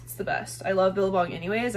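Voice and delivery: usual speaking voice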